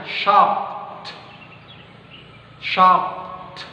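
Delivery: "Shocked" ending on k, the t dropped. Shown as a demonstration. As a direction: say "Shocked" with no audible t. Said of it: "kalian langsung drop T-nya". ("Shocked" tanpa bunyi T)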